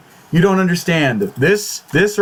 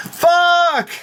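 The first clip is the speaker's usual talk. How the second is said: a pained outburst, pitched way up; anguished curse; 13 semitones higher than usual. Real, not acted. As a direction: blurt it out in real despair. Reacting to a character's death.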